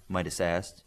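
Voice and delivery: ordinary delivery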